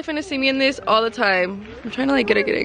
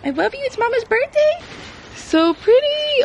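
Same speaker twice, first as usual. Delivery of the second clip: high cooing dog talk; pet voice; 11 semitones higher than usual. Real, not acted. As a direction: coo at the puppy, high and sweet. Talks to her Frenchie dog.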